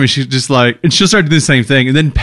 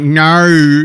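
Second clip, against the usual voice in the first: mock Aussie "No", higher, with an added R; imitated Australian accent; 4 semitones higher than usual. Host mocking Americans who imitate Aussies, exaggerated fake Australian accent. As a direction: overdo an American's attempt at Australian. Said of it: "when Americans try to imitate Aussies".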